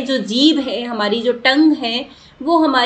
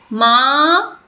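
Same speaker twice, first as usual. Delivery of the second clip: माँ called out louder, vowel stretched; calling mother; 4 dB louder, much slower than usual. Shown as a demonstration. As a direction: call माँ as if to your mother. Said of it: "पुकारोगे तो कैसे बोलोगे?". (माँ को ज़ोर से पुकारते हुए)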